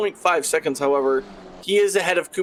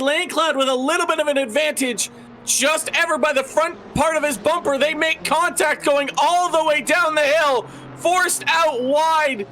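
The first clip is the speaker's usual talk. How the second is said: excited commentary; 9 semitones higher than usual. Real, not acted. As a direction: call the battle with rising excitement. Live battle down the hill.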